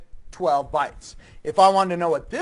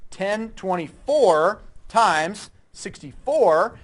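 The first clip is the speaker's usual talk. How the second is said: slow dictation; 2 semitones higher, 4 dB louder, much slower than usual. Real, not acted. Teacher dictating numbers to class.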